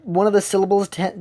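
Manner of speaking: ordinary talk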